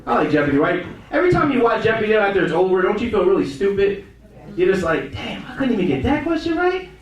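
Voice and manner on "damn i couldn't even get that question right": high-pitched baffled self-reproach; incredulous, climbing high to the end; mock dismay. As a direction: whine it upward in disbelief. Mimics feeling stupid after Jeopardy.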